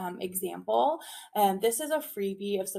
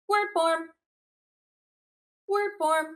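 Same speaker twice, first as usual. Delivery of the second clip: Word form snapped out higher and louder; high crisp call; 7 semitones higher, 5 dB louder than usual. Shown as a demonstration. Teacher leading a call and response, high and loud. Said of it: "I'll say it, you respond".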